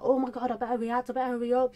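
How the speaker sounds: reliving a mild flustered rush; excited urgency. Retold or imitated. YouTuber hearing a new song is out, excited urgency.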